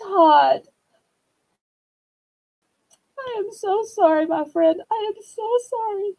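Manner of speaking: high, tearful sympathy breaking into tears; choked-up condolences, voice cracking high; real crying. Real, not acted. Streamer consoling a viewer who lost his wife, crying. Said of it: "I didn't mean to be so emotional".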